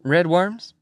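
RED WORMS as a question, rising high at the end; puzzled McMurphy. Acted. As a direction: query RED WORMS with a climbing tune.

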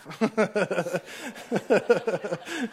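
long chuckling laugh; real laugh. Real, not acted. Preacher admitting he let go, long laugh. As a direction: laugh helplessly at your own joke.

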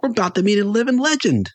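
high-pitched thrilled announcement; excited fan. A narrator's reading. Fan to companion about meeting his idol, excited. Eager fan voiced in story.

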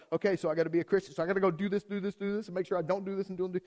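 fast, rattled-off to-do list; mock dutiful checklist. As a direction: rush through the rules as an overwhelmed believer.

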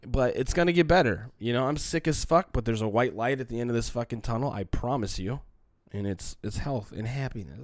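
normal talking voice

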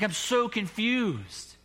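slow, high, pleading confusion; confused man. Retold or imitated. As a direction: stretch out the helplessness. Confused man voiced again.

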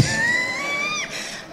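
real laugh squeezed into a squeal; high squealed laugh. Real, not acted. Guest laughing off why she got the gig, squealed laugh.

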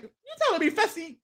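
high, fast mock disbelief; shocked contestant. Retelling a TV scene, mock shocked rival.

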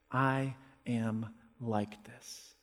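slow, soft, humbled admission; quiet humble confession; hushed confession. Retold or imitated. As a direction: say it slowly under the breath, owning it.

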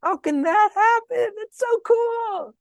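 very high, squeaky, gushing amazement; full of wonder. Retold or imitated. Mary questioning the angel, full of wonder. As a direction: marvel in an exaggerated high voice, stretching the end. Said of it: "a question that's full of wonder".

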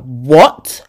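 loud "what?" swooping upward; mock shocked reaction. Complainers reacting to your moan, mock incredulous. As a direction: imitate an incredulous friend.

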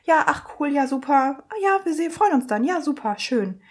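high, lilting agreeable chatter; suddenly friendly receptionist. Retold or imitated. Receptionist saying goodbye to cheerful patient, sweet and friendly. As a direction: imitate delighted easy small talk. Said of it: "So komplett anders".

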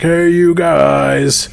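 high, slow, stretched-out hey you guys; drawn-out goofy greeting. Acted. Odd newcomer Ox greets bandits, high and drawn out. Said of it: "And he's like".

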